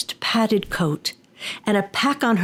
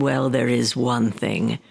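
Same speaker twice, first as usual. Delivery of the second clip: Tengu demon voice; 6 semitones lower than usual. Acted. Demon confessing his fear to the old woman, low. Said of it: "the Tengu simply said".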